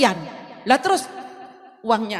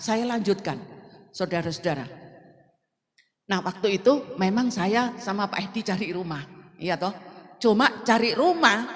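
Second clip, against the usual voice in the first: ordinary talk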